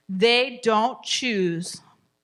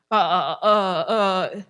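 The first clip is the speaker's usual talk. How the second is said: mock child sobbing; 5 dB louder, slower than usual. Child fussing at consequence.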